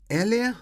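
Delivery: "Elia?" ends in a sharp high rise; rising question ending. Shown as a demonstration. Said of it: "Nunca termine uma pergunta desse jeito". ("Elia?" com entonação subindo no fim)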